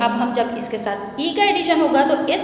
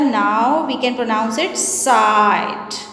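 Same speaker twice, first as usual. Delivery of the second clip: very slow, each word spaced out; slow teaching delivery; 3 semitones lower, much slower than usual. Shown as a demonstration. Teacher telling the class the new word, very slowly. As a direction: spell it out slowly for young learners. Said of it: "इस magic ई ने आके क्या काम किया?".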